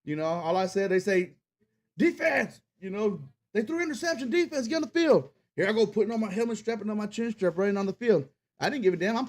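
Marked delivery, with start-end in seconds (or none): 2.0-5.3 high, carrying call to the defense; coach calling out. Retold or imitated. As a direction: bark it across the sideline like a coach. Coach calls defense onto field.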